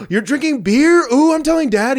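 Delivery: high, loud gleeful squeal; delighted to snitch; singsong I'm-telling squeal; gleeful tattling. Retold or imitated. Tattling on brother.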